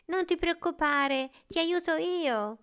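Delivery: put-on high storybook boy voice; reassuring boy. A narrator's reading. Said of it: "Nicola disse alla stella".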